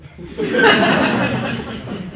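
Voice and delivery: loose, open laugh; burst of laughter. Real, not acted. The pranksters laugh at Malvolio's exit. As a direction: let the laugh spill out.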